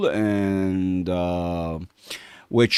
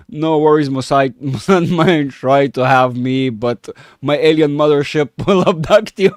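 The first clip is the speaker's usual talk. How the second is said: loud, higher joking through laughter; amused laughing; 4 semitones higher, 6 dB louder than usual. Real, not acted. Streamer jokes to chat about an alien mothership, laughing. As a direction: crack up while making a mock threat.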